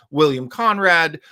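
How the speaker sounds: usual speaking voice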